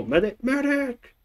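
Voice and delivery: urgent call, pitch leaping high; man down shout. Real, not acted. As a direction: yell for the medic across the field. Calling for a medic in game.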